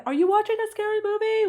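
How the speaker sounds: put-on high, timid child asking, eyes shut; little girl. Mom imitates her little one peeking in at a horror show, high and timid. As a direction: ask in a high, small, nervous child's voice. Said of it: "but now she'll walk in and go".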